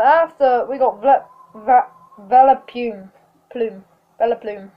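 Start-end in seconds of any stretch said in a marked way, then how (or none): none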